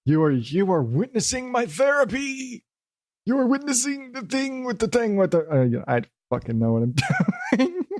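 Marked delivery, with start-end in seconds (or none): 0.0-5.5 high, put-on showman voice; mock-grand, pitched way up; hammed-up proclamation. Hammed-up announcement of his therapy.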